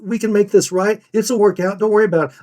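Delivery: high-pitched, flustered reassurance; high voice, tripping over the reassurance; forgiving buyer. Acted. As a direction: act out a customer hurrying to wave off the apology. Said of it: "they're already saying".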